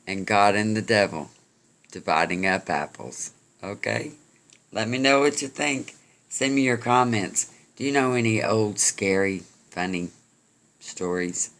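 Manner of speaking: plain reading voice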